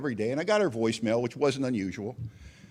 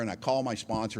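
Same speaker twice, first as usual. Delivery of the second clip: ordinary delivery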